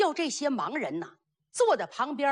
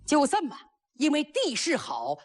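usual speaking voice

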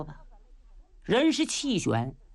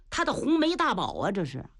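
usual speaking voice

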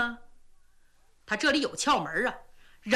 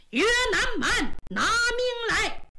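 higher, slow drawn-out battle challenge; battle cry; 8 semitones higher, slower than usual. A narrator's reading. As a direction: roar the threat as you charge. Enemy general charging Yue Fei.

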